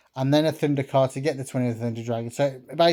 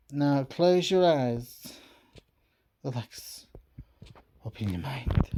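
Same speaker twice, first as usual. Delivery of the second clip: slow soothing guide; slower than usual. Acted. Mystic guiding into trance.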